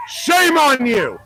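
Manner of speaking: slow, high, drawn-out shout; shouted shaming. Real, not acted. Shouting at building.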